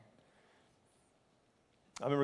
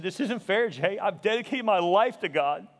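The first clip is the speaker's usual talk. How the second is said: loud, high, anguished protest; anguished yelling; 10 semitones higher, 8 dB louder than usual. Retold or imitated. Seminary student yelling to best friend about God, angry and anguished. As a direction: cry out at the unfairness, building higher and louder. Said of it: "going between anger and yelling and crying".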